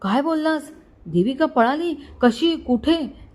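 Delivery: high, panicky questions; frightened. Acted. Mother hearing her daughter has run off, frightened. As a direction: gasp out each question, pitch up. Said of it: "घाबरून बोलली".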